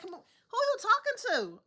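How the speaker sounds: slow, high indignant retort; who do you think you're talking to, drawn out; mock offended comeback. Indignant retort to rowdy riders.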